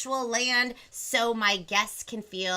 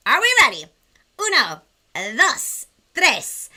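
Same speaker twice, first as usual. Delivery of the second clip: excited countdown; 3 semitones higher, 10 dB louder than usual. Real, not acted. Hyping kids for a countdown.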